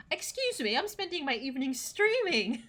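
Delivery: high, loud, playfully offended retort; mock indignation. Streamer defending their evening to chat, mock indignation. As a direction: protest it as if affronted, pitch up.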